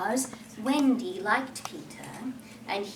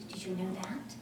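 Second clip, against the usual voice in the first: hushed secret whisper; 5 semitones lower, 11 dB quieter than usual. Acted. Sharing a secret with listeners.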